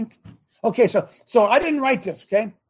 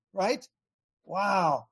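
ordinary talk

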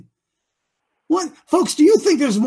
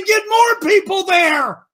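slow, loud, higher shout; emphatic shout; 8 semitones higher, 4 dB louder, slower than usual. Real, not acted. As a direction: push it like a command. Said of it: "I say".

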